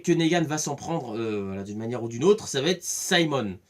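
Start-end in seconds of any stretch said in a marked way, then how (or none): 2.5-3.6 Simon said saimon, English diphthong on the first syllable; English-style Simon. Shown as a demonstration. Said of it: "je le dis avec l'accent".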